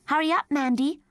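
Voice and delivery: mother calling her daughter to hurry. Acted. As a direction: call across the flat. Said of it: "called her mother".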